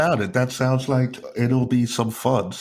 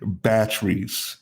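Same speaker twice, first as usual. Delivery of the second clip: drawn-out word for a confused listener; slow, careful repetition; slow repeat; slower than usual. Real, not acted. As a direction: say it slowly so they catch it. Repeats word slowly when misheard.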